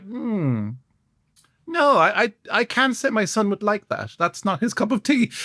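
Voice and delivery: politely turning someone down; a touch of prim mother; imitating mum. Mum voiced declining politely.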